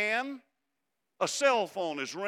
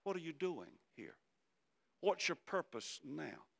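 much softer, tender question; gentle whisper; 8 dB quieter than usual. Acted. God gently whispering a question to the listener, hushed. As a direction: lean in and ask it softly. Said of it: "gently whisper this question to us".

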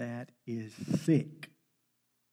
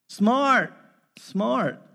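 proud exclaim; 7 semitones higher, 8 dB louder than usual. Real, not acted. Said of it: "preacher praising son's cleverness".

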